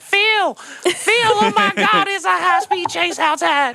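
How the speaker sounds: very high-pitched frantic yelling woman; mock-hysterical squeal, stretched out at the end; panicked lady. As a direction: shriek to Phil in an exaggerated high lady voice. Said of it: "the lady inside's like".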